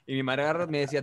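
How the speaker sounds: ordinary talk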